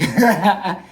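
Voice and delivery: loud, high burst of laughter; real laugh. Real, not acted. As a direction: crack up at your own outburst. Laughs at his own outburst.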